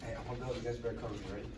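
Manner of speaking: low hushed voice while hiding. Real, not acted. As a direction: keep it down so they can't hear. Whispering while hiding in game.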